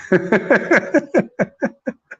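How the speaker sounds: high, bubbly giggle. Real, not acted. Preacher laughing at a scared listener, high giggle. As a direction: let a giddy laugh spill out.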